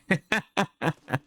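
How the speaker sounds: high breathy laugh; real amused laughing; laughter. Real, not acted. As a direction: burst into a laugh at yourself. Laughing at himself.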